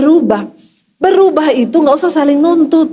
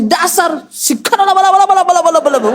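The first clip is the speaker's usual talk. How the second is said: rapid-fire babbling burst; mock speaking in tongues; 2 semitones higher, faster than usual. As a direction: lose your temper into fake holy gibberish. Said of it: "bahasa roh keluar".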